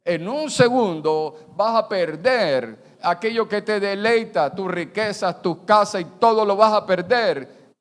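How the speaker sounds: loud, high voice of God, slow at first; God warning his people loudly; stern verdict. Acted. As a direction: pronounce judgment. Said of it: "Dios le dice".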